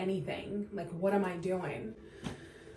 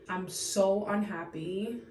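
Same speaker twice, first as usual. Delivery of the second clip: ordinary delivery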